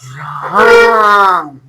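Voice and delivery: राम with the आ stretched very long; prolonged calling vowel. Shown as a demonstration. Teacher showing the call used in drama, राम hugely drawn out. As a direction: swell through one endless आ in राम, as if calling out. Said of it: "किसी को पुकारने में या नाटक के".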